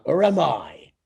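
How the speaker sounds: teasing villain. Acted. Taunting Koopa.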